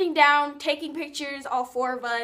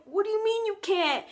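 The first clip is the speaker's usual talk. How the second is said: high-pitched indignant imitation; mock upset boy; 8 semitones higher than usual. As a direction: question it in mock disbelief. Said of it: "He gets all mad".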